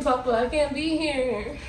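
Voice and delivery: ordinary delivery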